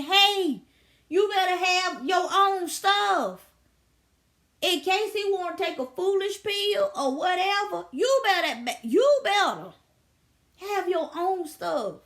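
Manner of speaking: slow, loud, insistent warning; a wife warning other women to keep their own money; emphatic warning. Retold or imitated. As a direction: lean hard on every word of the warning. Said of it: "they wife even said".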